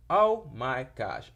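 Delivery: loud, drawn-out gasp of dismay, pitched high; sudden realization. Real, not acted. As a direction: exclaim as you catch your own big omission. Realizes she skipped favorite moment.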